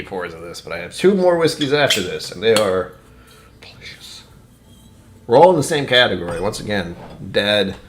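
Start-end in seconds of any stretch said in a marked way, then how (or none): 3.6-4.2 very soft whispered relish; savoring whisper. Real, not acted. Host on two more whiskeys after this, savoring whisper. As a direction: whisper it as a treat.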